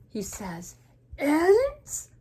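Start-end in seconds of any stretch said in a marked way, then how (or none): 1.2-2.1 loud, high rising question; almost a shout of alarm; panicked. Acted. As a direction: Gerald aghast, voice shooting up. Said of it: "he's panicked".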